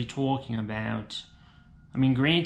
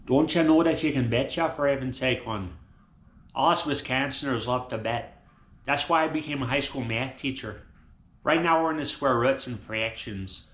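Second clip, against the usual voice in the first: put-on Wisconsin accent; 3 semitones lower than usual. Wisconsin math teacher talking about his kiddos, Wisconsin accent. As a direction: lean into a Wisconsin accent, hon. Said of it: "in my accent".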